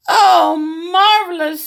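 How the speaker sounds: put-on high, slow, delighted old grandma; grandma gushes with joy. Grandma welcomes her visitor, high and slow.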